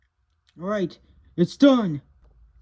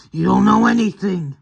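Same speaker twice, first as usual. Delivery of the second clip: louder, higher flare of anger; angry retort; 6 semitones higher, 7 dB louder than usual. Acted. Confronting Pete about his wife, angry shout. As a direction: cut him down hard.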